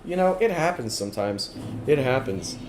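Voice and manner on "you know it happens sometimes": ordinary delivery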